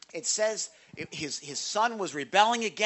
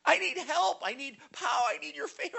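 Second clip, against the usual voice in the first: high desperate plea, fading softer to the end; crying out loud; 10 semitones higher, 3 dB quieter than usual. Retold or imitated. David crying out to the Lord, a high desperate plea. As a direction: cry out to the Lord, starting high and loud then sinking. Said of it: "I'm crying out loud".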